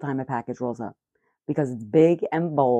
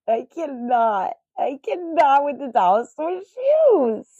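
high, loud, laughing through the words; crying with laughter, barely getting it out; real laughing fit, slow and pitched up; laughing hard; 10 semitones higher, 5 dB louder, slower than usual. Real, not acted. Cracking up at cheap shoes.

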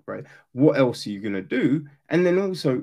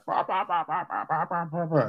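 lightly mimicked muffled teacher drone, softer; mock the droning adult from Charlie Brown; 3 semitones higher, 5 dB quieter, faster than usual. Mocking a lecturing coach as a mild Charlie Brown drone. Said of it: "we do the whole Charlie Brown".

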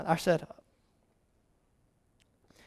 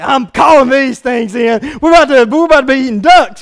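louder, shouting and gleeful; excited shout; 3 semitones higher, 8 dB louder than usual. Retold or imitated. Hunter sure of a duck dinner, shouting excitedly. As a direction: crow that you've got them. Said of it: "I'm thinking, I'm like".

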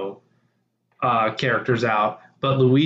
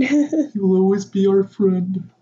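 higher, slower, hammed-up solemn vow; mock solemn tribute; 7 semitones higher, slower than usual. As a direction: play it like a fake eulogy. Mock memorial for dead character.

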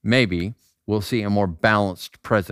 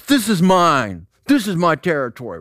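mock territorial claim; 6 semitones higher, 4 dB louder than usual. Acts out territorial gym guys.

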